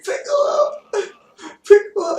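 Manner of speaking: Gohan voice scream. Retold or imitated. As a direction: shriek for your mentor. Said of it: "the Gohan voice".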